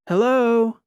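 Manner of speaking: high, loud call into the dark ship; shouted call. Acted. Lita shouting into the dark ship to find someone, loud call. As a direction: call out hard into the empty corridor. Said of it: "she shouted to no answer".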